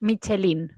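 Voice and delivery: "Michelin" said the Spanish way; "Michelin" with Spanish sounds, mee-cheh-LEEN; Spanish pronunciation. Shown as a demonstration. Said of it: "Spanish say it?".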